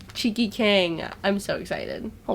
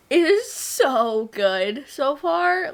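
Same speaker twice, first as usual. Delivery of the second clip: gushing; 5 semitones higher, much slower than usual. Real, not acted. Gushing about the book.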